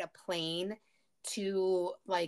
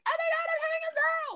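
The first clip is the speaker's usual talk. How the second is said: high, fast, squealing excitement; excited gushing; faster than usual. Retold or imitated. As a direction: squeal it like a clueless gushing parent. Mocking the oblivious couple.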